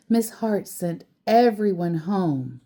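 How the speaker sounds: plain reading voice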